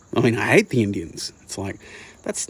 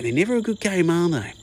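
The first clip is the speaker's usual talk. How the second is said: mock dad voice, pitched much higher, pressing never; hammed-up dad, much higher and insistent; imitating dad, higher; 9 semitones higher, 4 dB louder than usual. Son mocking dad's scorn for the game in a higher, hammed-up voice. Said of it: "make the argument of".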